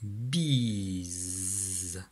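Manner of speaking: "Bise" very drawn out, final z buzzing; low, slow "Bise" with held voiced z; dictated syllable. Shown as a demonstration. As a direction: stretch "Bise" low and long. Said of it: "Troisième syllabe".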